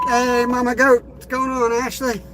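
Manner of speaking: a light sing-song hello to a friend; friendly shout-out. Real, not acted.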